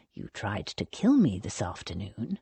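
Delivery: low, soft accusation. A narrator's reading. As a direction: accuse him quietly, voice dropped. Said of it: "he said in a lowered voice".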